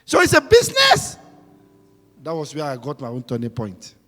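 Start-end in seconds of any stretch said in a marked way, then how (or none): 0.1-1.2 astonished. Retold or imitated. Astonished reply to the Lord.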